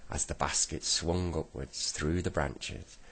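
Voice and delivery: usual speaking voice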